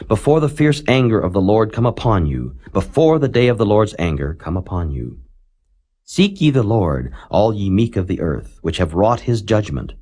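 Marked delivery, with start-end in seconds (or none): none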